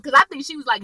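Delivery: usual speaking voice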